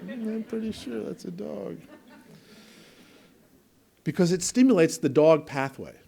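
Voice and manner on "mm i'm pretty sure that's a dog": put-on high, soft small-child voice; little boy. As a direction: doubt Grandma in a quiet, high-pitched kid's voice. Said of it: "he'll go".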